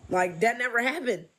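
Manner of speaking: ordinary talk, nothing marked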